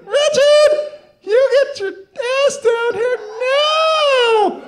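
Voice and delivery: high, loud, drawn-out furious mother's yell; angry mom yelling. Retold or imitated. Mother yells up at her son to come down now. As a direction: bellow it up the stairs like an angry mom. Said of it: "a lot louder and a lot more urgent".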